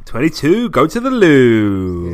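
loud bingo call, last word drawn out long; bingo caller. Pretend. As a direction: belt out the rhyme like a bingo caller. Calling out a bingo-style rhyme.

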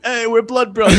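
high, loud, laughing mock dude; goofy proud dude. Cohost retelling a movie joke, goofy high laughing voice. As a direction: ham up a proud dumb guy through a laugh. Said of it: "the guy goes like".

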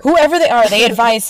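excited shout-back; loud echo. Real, not acted. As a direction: throw it back with a burst. Echoes co-host, louder and higher.

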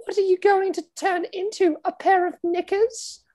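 high giggly posh lady voice; mock swooning aristocrat, laughing; laughing Camilla. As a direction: squeal it through a giggle. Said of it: "this is what Camilla says, laughing".

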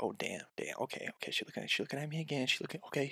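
very hushed, hurried self-talk; acted quiet fluster, repeating yourself; nervous inner monologue. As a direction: mutter it urgently under your breath. Panicky man talks to himself.

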